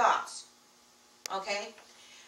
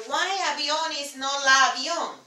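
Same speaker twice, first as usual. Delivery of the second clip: quoting a puzzled learner's question, higher and louder; imitated question; 5 semitones higher, 6 dB louder than usual. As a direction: voice the question people keep asking. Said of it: "people are, are always telling, you know".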